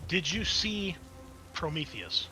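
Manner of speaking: ordinary talk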